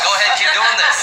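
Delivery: high, loud laughing encouragement; excited mom. Retold or imitated. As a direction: cheer him on, laughing.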